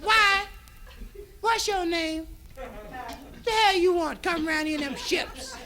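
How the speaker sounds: high, sharp put-on girl voice; girl on the beach sassing Columbus, high voice; sassy girl. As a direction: give him attitude from the beach. Indian girl's sassy reply.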